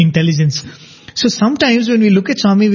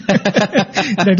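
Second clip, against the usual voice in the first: laughing through the punchline; slow words broken up by laughing; real laughter; 4 dB quieter than usual. Real, not acted. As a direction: tell the joke's payoff while laughing. Laughing at his own punchline.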